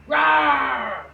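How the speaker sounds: playful roaring growl; loud mock dinosaur roar. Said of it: "Ferocious, right?".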